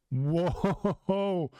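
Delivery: real amazement; amazed whoa. Real, not acted. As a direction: gasp at the page. Reacting to the page.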